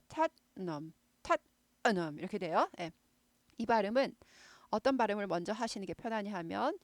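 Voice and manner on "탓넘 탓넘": 탓넘 cut off sharply after 탓, then 넘; 탓넘 with a clipped stop on 탓; staccato Tottenham. Shown as a demonstration. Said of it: "탓 하고 여기 스타카토 딱 있어요".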